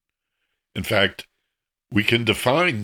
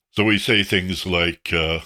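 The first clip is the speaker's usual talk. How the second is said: ordinary talk, nothing marked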